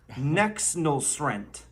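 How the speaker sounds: "Nexnosrent" drawn out slowly, each syllable spelled out; mock Bidenese word. Shown as a demonstration. Teacher to the audience, slow careful teaching voice. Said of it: "The first word I'm going to teach you today is".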